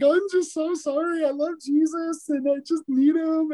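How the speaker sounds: high-pitched, hammed-up sobbing apology; exaggerated tearful wail, played for laughs; mock blubbering. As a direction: blubber it out high, imitating yourself in tears. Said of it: "I'm a blubbering idiot. Like".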